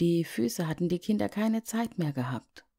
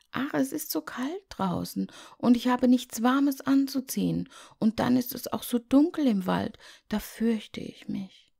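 put-on scared little girl; 9 semitones higher than usual. Liese hat Angst vor Wald.

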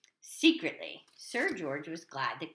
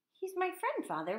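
usual speaking voice

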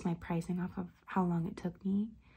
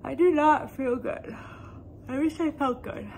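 loud, high whine about feeling unwell; sick complaint; 6 semitones higher, 9 dB louder than usual. Real, not acted. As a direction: wail it out miserably, louder and higher.

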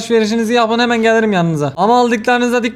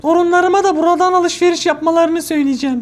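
higher, lightly voiced sweet old lady; old lady customer; 9 semitones higher than usual. Gamer voicing the old customer to the cashier, sweet granny voice. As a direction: play the pleased granny at the till.

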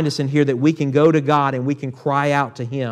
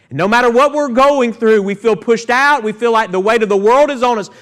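rising preaching; 5 semitones higher, 4 dB louder than usual. Real, not acted. Preaching builds to full force.

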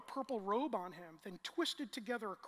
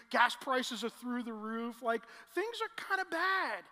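full-force preaching; 3 semitones higher, 7 dB louder than usual. Real, not acted.

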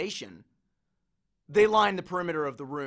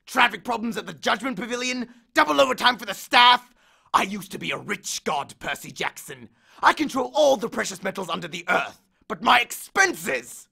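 louder, higher aggrieved rant; aggrieved god; 6 semitones higher, 5 dB louder than usual. Acted. Hades complains to Percy about money, rising rant.

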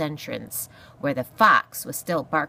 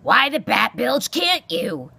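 loud, slow, high-pitched demand; exasperated porcupine; 6 semitones higher, 7 dB louder than usual. Acted. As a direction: demand it loudly, stretching the words. Porcupine demands an answer angrily.

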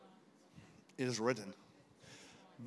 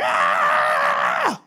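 a wild, loud, lower-pitched yell of "Ah"; madman shout; 8 semitones lower, 14 dB louder than usual. Retold or imitated. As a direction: let out a raw madman's shout. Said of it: "shout like a madman".

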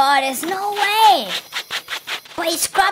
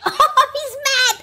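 usual speaking voice

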